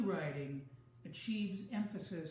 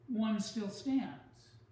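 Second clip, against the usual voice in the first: "one still stands." slow and louder, each word weighted; stressed ending; 3 semitones higher, 5 dB louder, much slower than usual. Shown as a demonstration. Teacher reading an example sentence to her class, slowly stressing "one still stands.". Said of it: "So the emphasis in this sentence is at the end".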